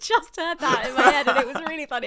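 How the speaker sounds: belated burst of laughter at a joke; cracking up. Real, not acted. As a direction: apologise while laughing hard. Cracks up at missed cereal joke.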